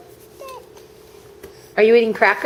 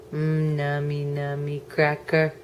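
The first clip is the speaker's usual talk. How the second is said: soft cooing; 5 semitones lower, 8 dB quieter, much slower than usual. Acted. Cooing over tasty cracker.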